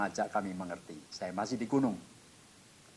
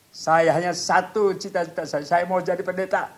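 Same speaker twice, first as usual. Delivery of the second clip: high, loud heartfelt pledge; fervent vow; 6 semitones higher, 6 dB louder than usual. Retold or imitated. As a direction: declare it to God with full conviction. Retold fervent vow to God.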